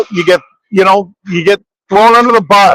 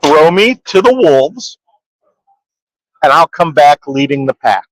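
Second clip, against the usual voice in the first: slower, weightier second reading; slow dramatic repeat; 3 semitones lower than usual. Shown as a demonstration. Repeating the quote slowly to the puzzled cohost. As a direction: lean on each word of the quote. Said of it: "Wait, what?".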